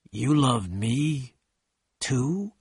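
very slow, hushed question rising at the end; slow, halting echo; slowly repeated. A narrator's reading. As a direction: stretch each word in disbelief. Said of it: "Gatsby repeated slowly".

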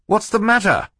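very high, anxious demand; alarmed question. A narrator's reading. Banker asking the others about screams, high alarm. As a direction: ask in high-pitched alarm.

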